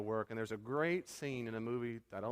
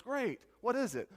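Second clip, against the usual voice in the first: high, louder burst of hope; eager suitor; 11 semitones higher, 4 dB louder than usual. Retold or imitated. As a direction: act out sudden eagerness for an answer. Acts suitor's hopeful eager question.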